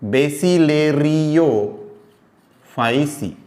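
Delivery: very slow, बेसिलेरियोफाइसी stretched syllable by syllable; slow teaching pronunciation; drawn-out syllables. Shown as a demonstration. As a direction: spell बेसिलेरियोफाइसी out slowly for the class. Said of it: "term said twice".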